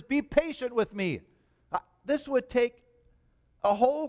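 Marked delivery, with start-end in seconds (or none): 0.0-1.2 higher, incredulous echo of the plea; be patient with me, raised in mock disbelief. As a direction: repeat the plea to show how absurd it is. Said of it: "You see the absurdity of that statement?".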